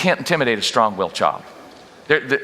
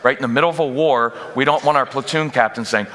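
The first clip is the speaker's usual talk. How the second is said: normal talking voice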